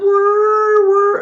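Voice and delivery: loud, high sustained whirring; imitated engine rev. Driver revving back when told to go home, imitated whir. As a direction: mimic a revving car with a long high note.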